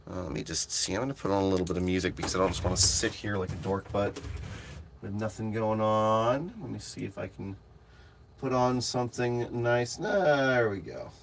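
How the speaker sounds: soft, idle murmur; absorbed self-talk. Real, not acted. Streamer looking for some music to put on, absorbed murmur. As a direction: think aloud quietly while busy.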